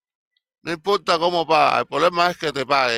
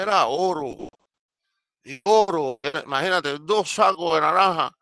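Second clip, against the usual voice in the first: normal talking voice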